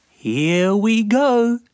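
very high, slow, gleeful little voice; squeaky sandfly. Acted. Sandy landing on the man, very high slow glee. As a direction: relish the moment like a tiny bug. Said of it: "said Sandy".

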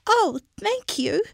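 high squeaky thanks; relieved little mouse thanking the lion; grateful mouse. Acted. Mouse character voice.

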